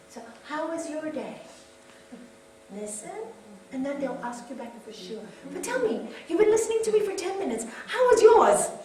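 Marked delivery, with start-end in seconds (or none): 5.5-8.7 mock demanding partner. Asking partner about their day.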